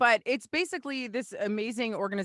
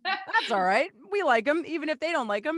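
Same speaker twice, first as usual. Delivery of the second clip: high, laughing reassurance; 8 semitones higher, 4 dB louder than usual. Real, not acted. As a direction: laugh through the words. Laughing reply to joke.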